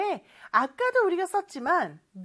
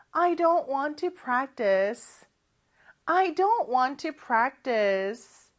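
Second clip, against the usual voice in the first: slow model reading; much slower than usual. Shown as a demonstration. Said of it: "요것도 한번 해볼까요?".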